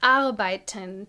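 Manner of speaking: "arbeiten" with the weight on the opening A; teacher's model "arbeiten", first syllable stressed. Shown as a demonstration. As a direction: hit the "ar" of "arbeiten", then let the rest fall away. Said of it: "The emphasis is on the A".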